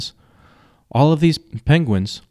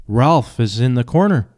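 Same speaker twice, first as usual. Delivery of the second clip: ordinary delivery, nothing marked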